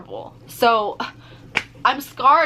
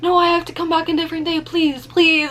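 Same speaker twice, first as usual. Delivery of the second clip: higher, fast, pleading no's; desperate begging; 8 semitones higher, 4 dB louder than usual. Retold or imitated. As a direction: plead in a panic not to go back. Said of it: "I was, like, begging him".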